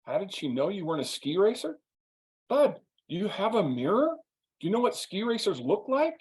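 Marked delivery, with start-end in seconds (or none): 2.5-6.2 teasing. Retold or imitated. Teasing his friend.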